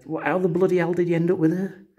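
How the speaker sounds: astonished, voice jumping high; incredulous whisper-shout. Retold or imitated. Asking his mate how he ended up with her, incredulous high.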